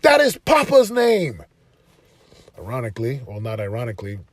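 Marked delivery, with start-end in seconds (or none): 0.0-1.4 high, loud, pleading to his son; emotional shout to son. Retold or imitated. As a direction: raise the voice high and loud, slowing on the name. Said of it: "I'm like".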